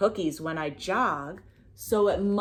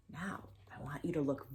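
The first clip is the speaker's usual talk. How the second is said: whispering; 5 semitones lower, 15 dB quieter, much faster than usual. Acted. Whispered instruction to kids.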